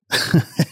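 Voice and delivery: real unvoiced giggle; stifle a helpless laugh; short nervous laugh; breathy laugh. Real, not acted. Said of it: "I'm not laughing 'cause it's funny".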